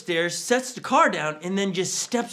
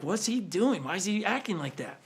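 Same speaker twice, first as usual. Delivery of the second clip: disgusted muttering; 8 dB quieter than usual. Retold or imitated. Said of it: "gives this face of disgust".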